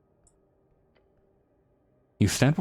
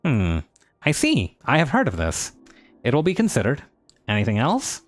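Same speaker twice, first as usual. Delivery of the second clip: higher, pushy nerdy merchant; smug bird shopkeeper, pitched up; 6 semitones higher than usual. Acted. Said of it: "a very nerdy voice".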